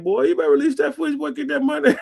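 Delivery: imitating a friend's Charleston accent. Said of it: "And that Charleston accent".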